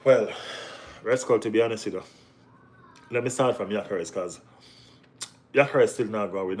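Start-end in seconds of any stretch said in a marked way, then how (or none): none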